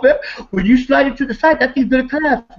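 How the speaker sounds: high, mocking voice imitating himself warning Jess; half-laughing mock warning. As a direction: raise the voice high and scoff. Retold argument, warning Jess.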